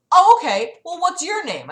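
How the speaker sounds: challenging caller. Retold or imitated. Acts out caller challenging her.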